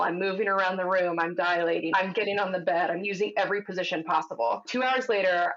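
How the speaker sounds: ordinary talk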